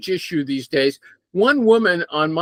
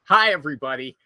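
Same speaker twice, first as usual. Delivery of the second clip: ordinary talk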